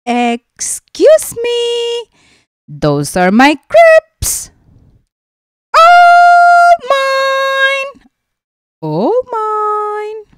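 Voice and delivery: high, loud squawking seagull demanding its lunch; a bossy gull shrieking for its food; greedy seagull voice. Acted. Seagull voiced again, crepes.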